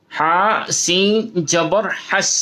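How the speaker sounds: ordinary talk, nothing marked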